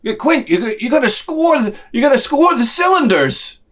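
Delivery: high, eager know-it-all voice; mock nerdy advice. Young guy advising Quint at the throttle, high and eager. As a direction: pipe up high with helpful advice. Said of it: "Rusty Fender Boy's like".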